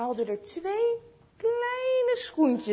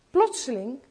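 ordinary delivery

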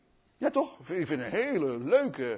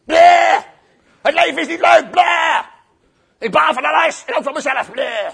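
loud, hammed-up sulky moaning; mock grouchy complaining; grumpy; 5 semitones higher, 12 dB louder than usual. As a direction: whine like a bleating, sulking grump. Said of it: "maar dan heel zagrijnig".

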